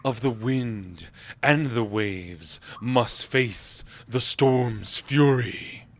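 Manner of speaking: slow, booming voice from a statue; booming god voice. Acted. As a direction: boom it out like a deity. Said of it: "a booming voice rise from the statue".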